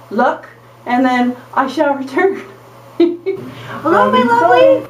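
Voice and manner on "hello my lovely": calling down a long hallway; raised voice to reach far off; shouting across a field. Real, not acted. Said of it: "I'm yelling because you're all the way down the hall".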